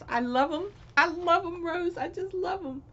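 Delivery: high delighted gushing over a gift. Real, not acted. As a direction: thank her with bubbling delight. Gushing over gifts from Rose.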